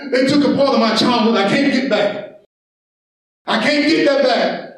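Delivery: driving, urgent pulpit delivery; impassioned sermon delivery; full-force preaching. Acted. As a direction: preach it hard to the congregation.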